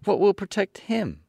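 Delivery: put-on high girl's voice, a touch worried; a child fretting over her dog; little girl asking anxiously; anxious Dorothy. A narrator's reading. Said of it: "said the girl, anxiously".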